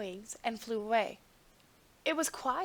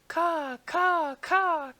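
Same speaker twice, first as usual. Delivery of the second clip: high, loud crow caws; crying crows; 6 semitones higher, 7 dB louder, much slower than usual. A narrator's reading. Crows crying to the king, high and loud. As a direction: cry out like crows. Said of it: "cried the crows".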